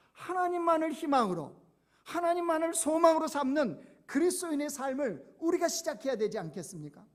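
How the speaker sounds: fervent preaching. Acted. Preacher urging the congregation to hope, loud fervent preaching. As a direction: swell up into a call to hope. Preacher rising to full force.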